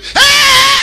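loud, high imitated scream; sudden piercing mock scream; lady's scream. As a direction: scream "Hey!" like a terrified lady passenger. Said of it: "a lady screamed".

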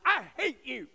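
put-on boy shouting at his mother's house; angry child yell. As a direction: yell it like a furious five-year-old. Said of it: "he was so angry at her".

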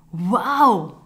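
loud, bright exclaimed wow; be blown away; amazed wow. Acted. Lies amazed at the future. (Lies roept vol verbazing "wauw")

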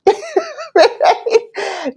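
bright high burst of laughter; high giggle trailing down. Real, not acted. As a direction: laugh it off, amused. Laughs after mom's quote.

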